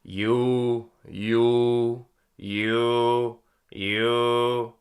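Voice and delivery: loud held U; twanged U. Shown as a demonstration. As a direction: sing the U steady with twang. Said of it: "Let's do the U".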